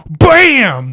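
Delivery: loud high mock gunshot; gunshot shout. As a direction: blurt the shot like a kid's bang. Gunshot in the scene.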